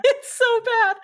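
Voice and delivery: high squeal through laughter; laughing. Real, not acted. Host owning up to copying people's words, high laughing squeal. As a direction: crack up while saying it, pitch shooting up.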